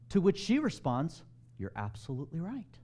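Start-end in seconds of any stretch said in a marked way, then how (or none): 1.6-2.8 quiet, tentative agreement with a rising end; soft concession. Acted. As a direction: concede it softly, as if taking it in. Acted reply, first softer take.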